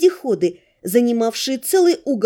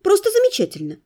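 sarcastic; 8 semitones higher, faster than usual. Acted. Sarcastic reply to stable tour.

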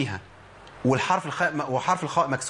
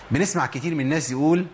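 ordinary talk, nothing marked

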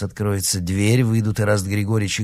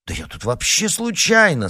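higher hasty protest; exclaiming; 10 semitones higher, 3 dB louder than usual. A narrator's reading. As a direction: blurt out innocence. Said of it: "сразу воскликнул Артём".

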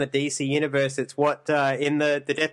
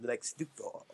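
low, soft villain voice; low, smug Lex Luthor voice; Lex Luthor impression; 3 semitones lower, 14 dB quieter than usual.

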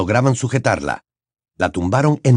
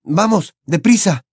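urgent; 9 semitones higher than usual. A narrator's reading. Harry urges Hagrid.

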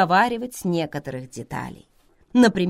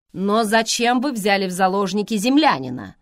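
stern; 4 semitones higher, 6 dB louder than usual. Acted. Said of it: "сурово сказал Томас".

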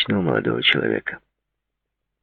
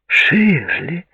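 high-pitched exclaimed name; delighted exclamation; 14 semitones higher than usual. A narrator's reading. Woman greeting her friend's engagement news, exclaiming high. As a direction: cry out the name, pitched well up. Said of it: "воскликнула Клаудия".

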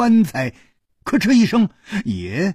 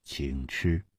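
eerie low offer; 10 semitones lower, 11 dB quieter than usual. Acted. Crying-mask man offers the corpse.

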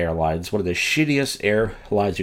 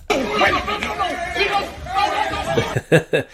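high cackling laugh. Real, not acted. Host laughing at the clip.

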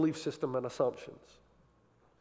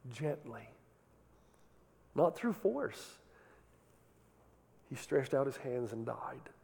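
gentle hush; 6 dB quieter, slower than usual. Acted. Softens to describe Jesus.

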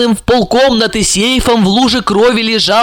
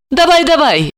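high, coaxing urging; encouraging; 9 semitones higher than usual. A narrator's reading. Olga urging stepson to drink, encouraging. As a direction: egg the listener on. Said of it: "подбодрила его Ольга".

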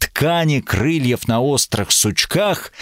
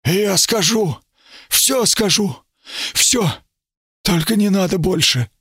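higher, hoarse and rushed; hurried rasping plea; 8 semitones higher than usual. A narrator's reading. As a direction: beg fast in a rasp. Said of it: "он прохрипел торопливо".

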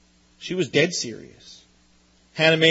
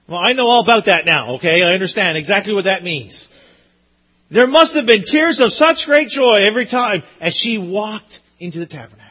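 normal talking voice

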